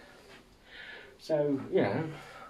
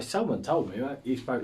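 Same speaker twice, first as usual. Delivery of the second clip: ordinary talk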